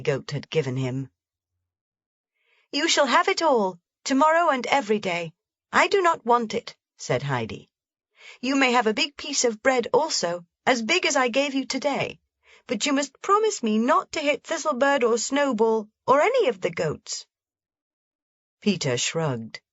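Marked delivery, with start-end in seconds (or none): 2.7-6.8 put-on high, quick eager girl; generous little girl rushing; Heidi, eager. A narrator's reading. 8.4-17.3 put-on high, quick eager girl; generous little girl rushing; Heidi, eager. A narrator's reading.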